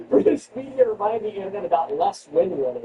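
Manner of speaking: normal talking voice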